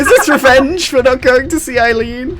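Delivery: high, loud comic protest; exasperated shout. Real, not acted. Lost player protests to friends about the game's revenge, exasperated shout. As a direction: wail it in loud, high-pitched disbelief.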